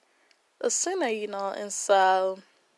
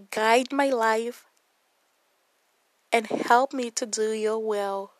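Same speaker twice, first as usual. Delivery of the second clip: salvation prayer. Acted. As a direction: lead them word by word in prayer.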